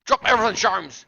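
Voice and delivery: loud, high calls of the name; urgent calling out. Acted. Calling the detective to drop everything, loud. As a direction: call out urgently for attention.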